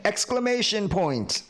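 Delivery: "Exclamation point!" shot up high and exclaimed. Shown as a demonstration. Teacher acting out the exclamation mark for students.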